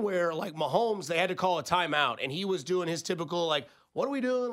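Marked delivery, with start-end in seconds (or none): 3.9-4.5 higher, frustrated quarterback; kinda frustrated. Retold or imitated. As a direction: een vleugje frustratie, hoger. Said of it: "Like, kinda frustrated".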